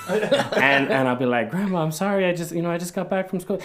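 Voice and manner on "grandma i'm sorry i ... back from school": put-on high-pitched pleading kid; apologetic grandson. Grandson excuses himself to angry grandmother, high apologetic pleading. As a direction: plead in a high, hurried kid's voice.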